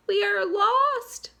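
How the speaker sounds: high, slow despairing cry. Acted. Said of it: "cried Miss Spider".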